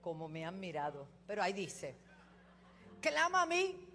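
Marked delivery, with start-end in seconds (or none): 3.0-3.9 loud, high, slow fervent preaching; preaching at full force. Acted. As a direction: hammer each phrase to the congregation. Preaching climax, building intensity.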